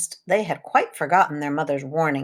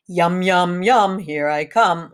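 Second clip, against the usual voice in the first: slow, gleeful wolf; lightly voiced greedy wolf heading down; gloating wolf; 4 dB louder, slower than usual. A narrator's reading. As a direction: savour each yum like a hungry wolf. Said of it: "laughed the wolf".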